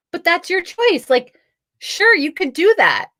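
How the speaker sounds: high, breezy retold agreement; mock agreeable. Agreeing with an angry lady on TikTok, mock agreeable.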